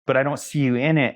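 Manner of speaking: lightly voiced insistent teacher; quoting the teacher. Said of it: "he'd be like".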